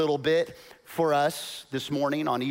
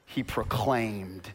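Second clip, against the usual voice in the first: usual speaking voice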